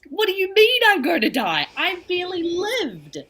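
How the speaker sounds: high, loud stunned cry of disbelief; a sudden high, panicked protest; shocked disbelief. Retold or imitated. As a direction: reel at the news as if it's happening now. Reacting to hearing she'll die.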